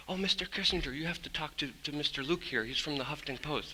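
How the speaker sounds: soft, hushed mock go-between; quietly obliging, voice well down; deferential introduction. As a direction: imitate a discreet aide making introductions. Voicing man introducing him to Kissinger.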